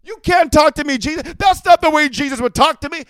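high, loud mock complaint; high offended mock complaint; mock complaint to Jesus. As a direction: whine it at Jesus, mocking them. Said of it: "Imagine they're gonna talk to Jesus".

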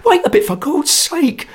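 very high, loud panicked cry; a terrified man begging them not to mix it; cry of terror. A narrator's reading. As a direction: scream for them to stop in terror. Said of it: "cried Sarcher in a sweat of terror".

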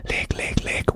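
very soft, voiceless call; furtive whisper. Retold or imitated. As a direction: whisper a name to get attention secretly. Retelling secret night-time call to friend.

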